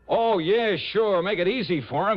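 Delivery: sarcastic. Acted. Sarcastic retort to the client.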